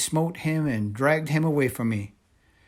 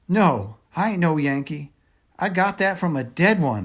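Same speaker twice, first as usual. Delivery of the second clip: a higher, louder frightened denial; sputtering; 7 semitones higher, 8 dB louder than usual. A narrator's reading. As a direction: blurt it out trying to convince them. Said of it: "I sputtered".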